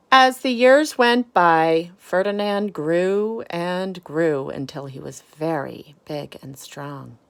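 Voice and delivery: lively picture-book storytelling; reading to children. Acted. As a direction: read it to the kids, expressive and clear.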